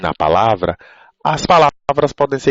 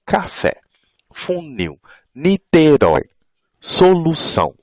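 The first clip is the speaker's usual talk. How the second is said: 'Café, funil, Niterói, solução' each in distinct beats, higher pitch; teacher's demo of 'Café, funil, Niterói, solução' as separate pulses; syllable impulses; 7 semitones higher than usual. Shown as a demonstration. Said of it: "assim, dois impulsos".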